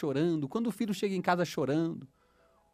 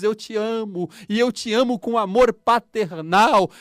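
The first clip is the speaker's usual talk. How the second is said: loud, rising fervent preaching; preaching at full force; 4 semitones higher, 6 dB louder than usual. Acted. Preacher proclaiming God's love to the congregation, fervent and loud. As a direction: proclaim it to the whole church.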